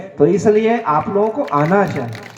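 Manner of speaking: ordinary talk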